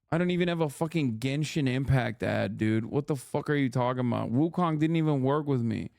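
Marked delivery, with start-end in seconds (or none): none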